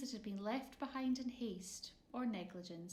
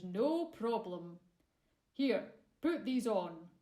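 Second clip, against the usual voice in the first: hearty giant; 7 dB louder than usual. A narrator's reading. Giant voiced in story.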